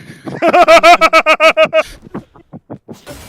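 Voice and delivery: high, loud burst of laughter. Real, not acted. Friend joking about a chihuahua, loud high laugh. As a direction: crack up loudly and high.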